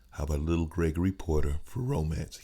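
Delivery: silky jazz station announcer; smooth jazz radio voice. Shown as a demonstration. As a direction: croon it like a jazz radio host. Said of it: "you got that smooth jazz voice".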